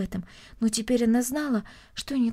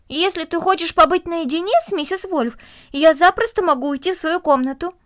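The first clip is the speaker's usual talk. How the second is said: put-on higher, louder boyish offer; lightly voiced eager boy; 7 semitones higher, 6 dB louder than usual. Boy offering to leave the girl alone with the woman, eager. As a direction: pitch it up, obliging and keen.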